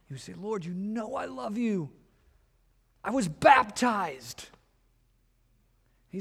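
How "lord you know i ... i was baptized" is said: protesting believer. Retold or imitated.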